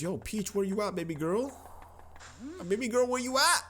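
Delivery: put-on high "baby girl, where you at?" call; hint of a Mario-voiced call; calling for Peach. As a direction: look around for Peach, crooning. Calling for the princess.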